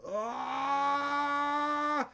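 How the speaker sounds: short high strained yell; mock effort cry. Wanda freezing time with a sharp high cry. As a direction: let out a sharp high cry as she pushes. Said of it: "She goes like that".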